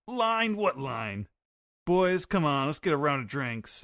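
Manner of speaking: high, loud, carefree pirate; voicing Roger. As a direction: play Roger cheerfully waving it off. Roger quoted while reading the comic.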